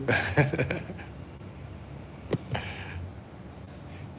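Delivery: long breathless wheezing giggle; silent wheeze laugh. Real, not acted. Vlogger laughing at his own wife joke, wheezing.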